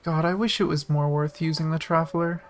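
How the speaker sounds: ordinary talk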